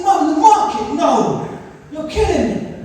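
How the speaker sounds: high, loud dismay; exasperated. Retold or imitated. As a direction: cry out in embarrassed despair. Horrified at market purchase.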